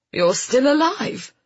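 high, rising astonishment; surprised relief. Acted. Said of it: "sounding more surprised and relieved than angry".

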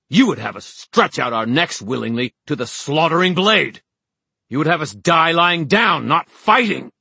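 high, loud ranting outburst; shouted, high-pitched fury; enraged tirade. A narrator's reading. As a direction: rant furiously at a crowd. Horace's screamed tirade goes on.

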